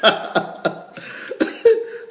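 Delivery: high airy unvoiced laugh; amused at the viewer's reaction; light wheezy giggle; breathy laugh. Real, not acted.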